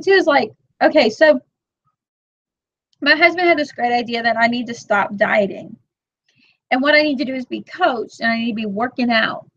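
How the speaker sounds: ordinary talk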